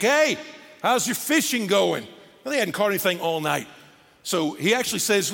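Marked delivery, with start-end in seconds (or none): 0.0-2.1 higher, lightly hollered friendly question; calling from shore. Acted. Some guy on the shore hailing fishermen, calling out. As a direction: call out casually across the water. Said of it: "Some guy on the shore".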